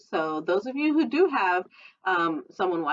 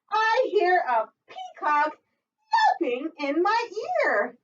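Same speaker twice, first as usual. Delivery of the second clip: peacock yelp; 12 semitones higher, slower than usual. Acted. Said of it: "sounds kinda like that".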